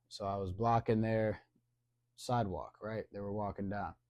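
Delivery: ordinary talk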